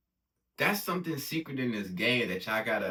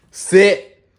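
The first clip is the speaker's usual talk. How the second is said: loud command; 7 semitones higher, 18 dB louder than usual. Real, not acted. Commanding the dog.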